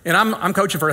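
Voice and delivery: normal talking voice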